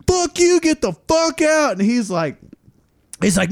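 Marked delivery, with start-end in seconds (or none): 0.1-1.7 high, furious yell; retold yell. To the much bigger guest, furious yell. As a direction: throw him out with a yell. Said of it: "I'm like".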